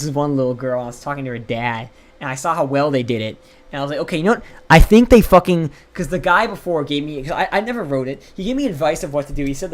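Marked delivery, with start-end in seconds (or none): 4.0-5.7 louder, slowed frustrated outburst; frustrated yell. Retold or imitated. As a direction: shout it out in frustration. Frustrated retold vow.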